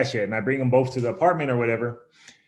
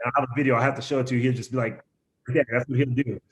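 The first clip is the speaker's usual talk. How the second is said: ordinary delivery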